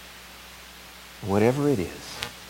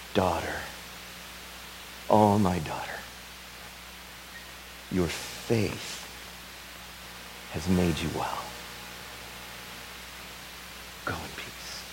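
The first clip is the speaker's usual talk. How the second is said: lower, soft and gentle, fading away; tender Jesus; 3 semitones lower, 7 dB quieter than usual. Acted. Jesus tenderly blessing the kneeling woman, soft and low. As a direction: reassure her softly, like a father.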